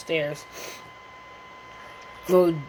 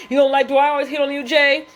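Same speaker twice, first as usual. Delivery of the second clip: raised, louder, rushed question; loud retold challenge; 9 semitones higher, 6 dB louder than usual. Asking Jay in front of Brandon, loud high challenge. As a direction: call it out fast and high, play-acting.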